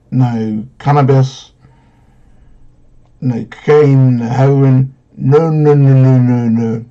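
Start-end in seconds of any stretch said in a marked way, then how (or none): none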